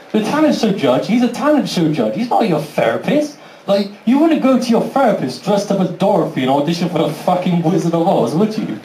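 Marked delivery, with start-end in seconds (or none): none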